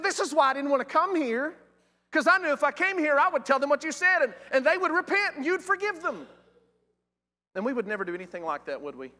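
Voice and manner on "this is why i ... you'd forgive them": high-pitched, loud indignant grievance; Jonah's angry complaint. Retold or imitated. Prophet complaining to God about mercy for Nineveh, indignant. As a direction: protest to God, climbing higher as it goes. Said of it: "and he became angry".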